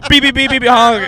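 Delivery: high beep-beep horn sounds; car horn noises. Pretend. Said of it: "stop honking".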